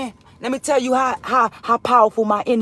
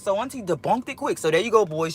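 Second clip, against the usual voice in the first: normal talking voice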